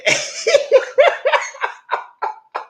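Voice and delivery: high, loud cackling laugh; helpless high-pitched laughter; long laugh. Real, not acted. Laughs at own boo-hooing.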